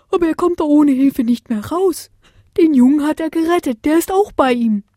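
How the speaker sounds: urgent owl bringing news. Acted. Owl speaks at the window.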